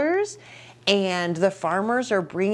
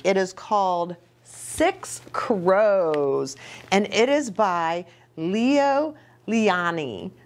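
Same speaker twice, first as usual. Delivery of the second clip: usual speaking voice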